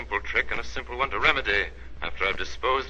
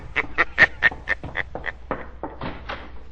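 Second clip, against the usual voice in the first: sinister laugh. Acted. Shadow's signature laugh.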